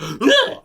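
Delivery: loud, high mock-fright gasp; startled gasp. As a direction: gasp as if something jumped out. Acts something jumping out to scare.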